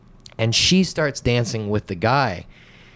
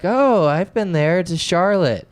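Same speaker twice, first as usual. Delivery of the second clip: mock friendly woman; 7 semitones higher than usual. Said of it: "And she's like".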